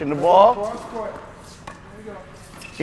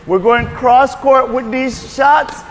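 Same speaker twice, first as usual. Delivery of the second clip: loud, higher and slow called instructions; calling across court; 5 semitones higher, 3 dB louder than usual. Real, not acted. Coach shouting drill directions to the ladies, loud. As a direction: project over the whole court.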